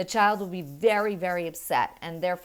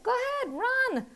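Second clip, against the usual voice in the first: much higher, bright go-ahead; cheerful release. Retold or imitated. Mother releasing daughter into park, high and cheerful. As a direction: let her run free. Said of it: "I said".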